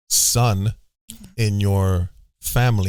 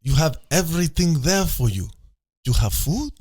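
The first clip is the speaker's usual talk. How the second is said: a hammed-up, clumsy Russian accent, a bit higher; bad Russian accent; 4 semitones higher than usual. Explaining his war plan to friends in a terrible Russian accent. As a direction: play a cartoonish Russian strongman badly in a Russian accent. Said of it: "That's a terrible Russian accent".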